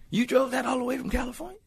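high-pitched incredulous sheriff; mock sheriff's disbelief; small-town sheriff. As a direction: ask it high, like an amazed local lawman. Sheriff questioning him in the story.

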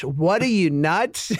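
ordinary delivery

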